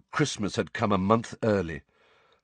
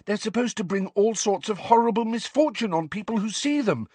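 high, anxious schoolgirl rush of warning; alarmed girl, pitched well up; 12 semitones higher than usual. A narrator's reading. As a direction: warn them in a high, worried voice. Alarmed Parvati goes on.

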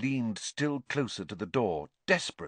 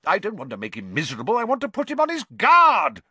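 quick, rising retort, louder at the end; 11 semitones higher, 9 dB louder, faster than usual. A narrator's reading. Father retorting to his wife, quick and rising. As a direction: fire back at his wife. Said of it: "retorted Mister Weasley".

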